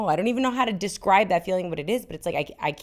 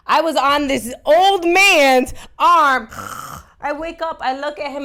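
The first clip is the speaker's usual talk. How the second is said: high, loud mortified telling; cringing storyteller building up; embarrassed excitement; 9 semitones higher, 7 dB louder, slower than usual. Real, not acted.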